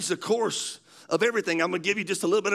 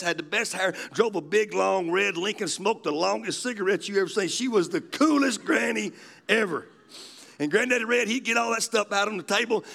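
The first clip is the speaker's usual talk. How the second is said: ordinary delivery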